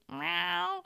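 drawn-out meow, mimicking a cat; imitated cat meow. Host copying a noise from outside, cat meow. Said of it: "making that kind of noise".

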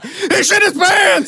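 high-pitched imitation of a shrieked announcement; mock hysterical outcry. Mimicking an adult with no support to give, high mock outcry. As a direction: cry it out high, drawing it out.

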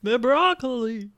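high, slow pedantic echo; nerdy voice. Acted. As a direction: play the fussy nerd. Echoing nerd voice.

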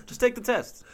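high squeaky mock voice; mock official. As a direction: squeak it out like a cartoon clerk. Mocking officials urging test.

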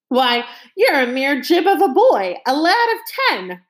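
loud, high mockery; scornful captain. A narrator's reading. Captain scorning the small boy, loud scorn. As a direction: sneer at a mere boy.

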